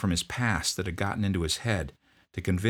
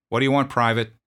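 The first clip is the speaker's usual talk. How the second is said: loud, higher, curt demand; drill sergeant; 6 semitones higher, 8 dB louder, faster than usual. Retold or imitated. Drill sergeant confronting a sniffling private, loud bark.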